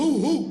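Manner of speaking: loud, high drawn-out hoo-hoo; whooping hoot. Acted. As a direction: hoot the company name like a siren. Host hoots sponsor's name again. (صياح هو هو عالي وممطوط)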